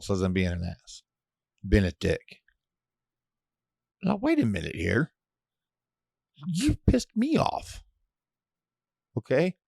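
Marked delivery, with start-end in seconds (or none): 4.0-9.5 indignant. Retold or imitated. Ranting at friend.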